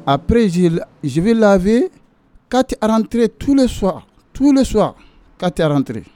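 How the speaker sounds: indignant, rising pitch. Real, not acted. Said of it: "complains of heavy workload".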